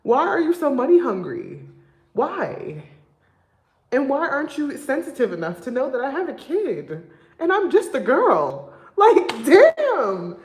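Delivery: loud, high, disbelieving complaint; indignant loud rant with laughs; exasperated outburst. Real, not acted. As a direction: vent it, voice climbing and loud.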